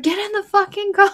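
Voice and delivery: high, sharp mock scolding; a fed-up friend yelling at her son, mock yell; mock yell at kid. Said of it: "yelling at him".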